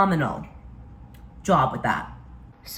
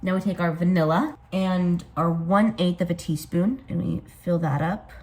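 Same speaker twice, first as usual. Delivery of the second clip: ordinary talk, nothing marked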